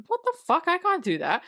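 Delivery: high-pitched mock disbelief; incredulous protest. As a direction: balk at an absurd order. Acts George balking at the order.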